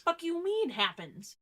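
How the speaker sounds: can't believe it; indignant disbelief. Real, not acted. Indignant at the drop.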